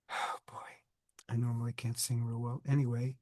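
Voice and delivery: whispery strained voice; hoarse whisper. Real, not acted. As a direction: voice nearly gone, barely voiced. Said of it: "with my voice like this".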